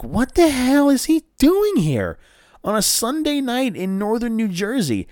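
hammed-up shock that he's here; retold disbelief. Asking himself why the wrestler is in his town, high baffled disbelief.

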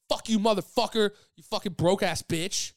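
loud, high mock-furious cursing; losing his mind. Homeless man cursing the comedian who refused him, mock-furious shout. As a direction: imitate an enraged outburst, hammering the insults. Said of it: "fucking lost his mind".

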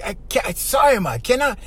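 high, pleading refusal; a touch of a tired daughter. Retold or imitated. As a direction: beg off to her mother.

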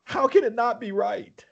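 high, fervent praise. Real, not acted. Telling his friend his love for God, fervent. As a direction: lift it up in awe. Emotional testimony.

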